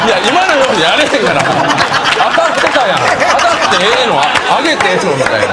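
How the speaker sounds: high, loud comeback between laughs; bursting with laughter at the punchline; laughing retort. Real, not acted. Laughing at the master's praise, loud high retort.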